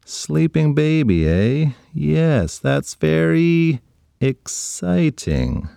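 slow, dragged-out unconvinced words; slow wary sarcasm. Acted. Ryan backing away from the sleeping baby, slow doubtful drawl. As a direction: back away while faking interest. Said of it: "slowly backing up".

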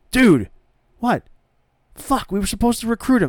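high-pitched, loud mock exasperation; exasperated scolding. Wrestler scolds his partner for botched recruiting, exasperated. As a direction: tell off your dopey partner, hammed up.

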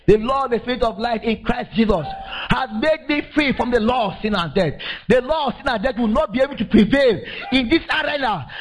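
high, ringing preacher call; fervent sermon climax; full-force preaching. Acted. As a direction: proclaim it to the church.

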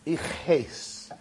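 soft, low, halting, long pauses; as if holding back tears, understated; mild retold tearfulness. Rabbi revealing his name to old man, softly tearful. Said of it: "starts crying and he says".

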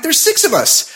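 high, exasperated retort; indignant protest. Retold or imitated. Own protest acted in retold quarrel.

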